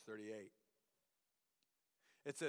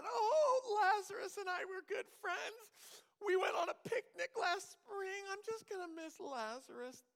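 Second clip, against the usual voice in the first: high, loud hammed-up blubbering; mock weepy whine; 5 dB louder than usual. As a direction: parody a sobbing friend, way over the top. Mocking a weepy sad Jesus.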